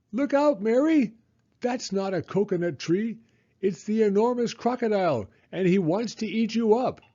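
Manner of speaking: high, loud cry of alarm; warning shout. A narrator's reading. Hippo warns Toto of the crocodile, loud shout. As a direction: yell it to save the boy. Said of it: "shouted Humpy Rumpy".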